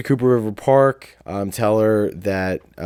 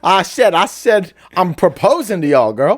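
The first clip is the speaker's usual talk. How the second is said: high, loud, blustering Southern cartoon; Foghorn Leghorn; 10 semitones higher, 6 dB louder than usual. Proposer riffing as a cartoon rooster, loud Southern bluster. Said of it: "That was Foghorn Leghorn".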